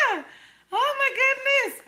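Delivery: high squealing oh my goodness; laughing exclaim. Real, not acted. Laughing high after embarrassing herself in front of viewers.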